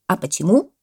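loud, pointed question; parrot exclaiming. A narrator's reading. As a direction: demand a reason. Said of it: "воскликнул попугай". (громкий вопрос попугая)

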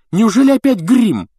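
very high, loud indignant outburst; exasperated exclamation. A narrator's reading. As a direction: cry out, fed up with the nonsense. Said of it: "воскликнула Гермиона". (возмущённо, громко)

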